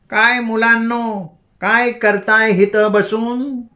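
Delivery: high, slow, sing-song voice of a crazed woman; eccentric madwoman. A narrator's reading. Madwoman Bhagi teasing the loitering boys, high and slow. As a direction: stretch it out high like a village madwoman.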